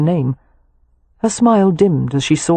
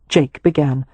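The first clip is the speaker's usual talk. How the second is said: usual speaking voice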